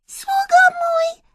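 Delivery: very high, loud squeaky mouse; Myszka squeaking. A narrator's reading. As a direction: squeal it as a mouse princess summoning a servant. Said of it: "zapiszczała Myszka".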